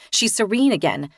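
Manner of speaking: ordinary delivery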